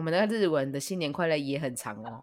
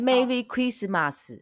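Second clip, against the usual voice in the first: put-on Japanese accent; 6 semitones higher, much slower than usual. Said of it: "马斯就发的音发的很重".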